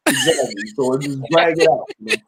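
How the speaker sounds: ordinary talk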